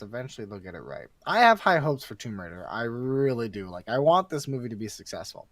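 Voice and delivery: normal talking voice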